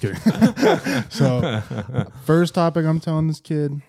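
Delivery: high laughing backpedal. Real, not acted. Joking, laughing.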